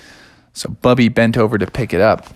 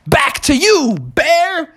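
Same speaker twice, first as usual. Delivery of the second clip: high-pitched snake comeback; cobra retort; much slower than usual. Acted. Cobra answering the bear, high-pitched retort. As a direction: throw it back in a high snake voice.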